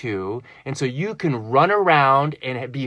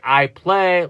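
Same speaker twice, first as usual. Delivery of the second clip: retold louder insistent reply; 5 semitones higher, 7 dB louder than usual. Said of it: "I'm like".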